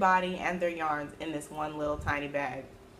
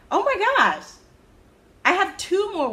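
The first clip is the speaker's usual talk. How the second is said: surprised; 13 semitones higher, 7 dB louder than usual. Real, not acted. Finds more works in progress.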